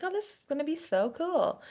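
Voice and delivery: high, light mock excitement; gleeful anticipation. Retells her own excited anticipation.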